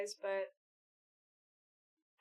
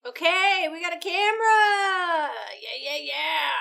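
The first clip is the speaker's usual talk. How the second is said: excited shout; 6 semitones higher, 12 dB louder than usual. Real, not acted. Thrilled about getting a camera.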